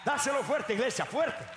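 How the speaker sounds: high, loud, driving sermon shout; rally the congregation with relentless shouted exhortation; full-force preaching. Real, not acted.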